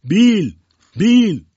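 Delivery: high, loud call of the name; shouting. A narrator's reading. Stranger yells to the captain across the room, shouting. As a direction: shout it out sharply at full voice. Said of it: "داد زد".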